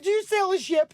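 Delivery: high and loud, innocent; naive teen question. Retold or imitated. Teen asking her father about his job, naive. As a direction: ask it wide-eyed and earnest. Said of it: "I was like".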